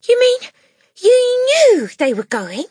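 put-on high, loud, disbelieving child; shocked cry. A narrator's reading. Matilda realises her teacher knew, high shocked cry. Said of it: "Matilda cried".

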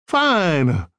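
put-on a touch of an Italian accent on Fine; Fine said evenly with mild accent; light Italian accent. A narrator's reading. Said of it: "She had a heavy Italian accent".

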